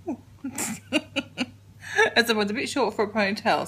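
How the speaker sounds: normal talking voice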